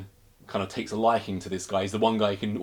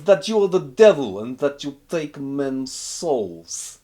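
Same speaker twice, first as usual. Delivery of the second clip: slow, louder, hushed-in-awe retelling of the rumour; awed prisoner; 5 semitones higher, 8 dB louder, slower than usual. Retold or imitated. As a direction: lean in like spreading a dark legend. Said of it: "And he goes".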